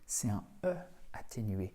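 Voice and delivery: hushed teacher aside; whispered aside. Shown as a demonstration. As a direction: whisper the explanation. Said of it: "whisper heard".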